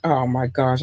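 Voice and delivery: ordinary talk, nothing marked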